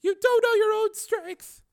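high, slow female voice, tearful; tearful woman. A narrator's reading. Susan to Steele after being hurt, tearful. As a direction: manage it through tears. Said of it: "weakly through the tears".

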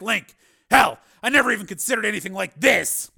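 high, loud, intense recounting; forceful, high-pitched and worked up; Steele, agitated. Acted.

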